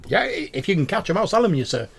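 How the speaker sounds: high, loud haggling voice; sly shopkeeper offer; cheeky goblin merchant. Acted. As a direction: tease the buyer with a catch. Goblin answers in character.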